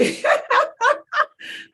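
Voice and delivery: a long, high-pitched laugh; high real laugh. Real, not acted. Coach laughing at her own joke about falling off the bus. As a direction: let a high laugh run out. Laughs after a quoted member.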